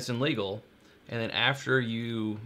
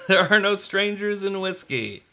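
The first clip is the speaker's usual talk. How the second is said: laughing; 9 semitones higher, 7 dB louder than usual. Retold or imitated. Motto repeated, laughing, louder.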